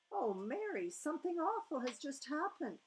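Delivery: a distressed queen telling her maid of the dream; Queen, upset. A narrator's reading. Queen voiced, higher and upset.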